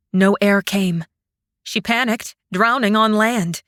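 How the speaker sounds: ordinary delivery, nothing marked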